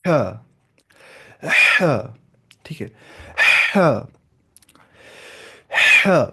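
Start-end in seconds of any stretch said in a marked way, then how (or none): 0.1-2.1 "ہاں" as "haa" with a long hissing breath before the vowel; practice ha. Shown as a demonstration. Teacher drilling throat muscles through practice, ha demo. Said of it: "practice کے ساتھ ساتھ ہی". 3.8-6.3 "ہاں" as "haa", forceful breath through a tight throat; contracted-throat ha. Shown as a demonstration. Teacher showing how to contract throat muscles, ha drill. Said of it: "contract کرنا ہے ان muscles کو".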